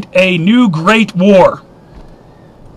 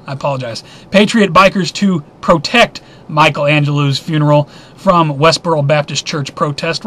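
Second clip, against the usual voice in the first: usual speaking voice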